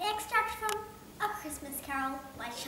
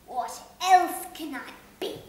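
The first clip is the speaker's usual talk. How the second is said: put-on louder, slow bitter old man; grumbling Scrooge; 3 semitones higher, 10 dB louder, slower than usual. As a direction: complain in bitter defeat.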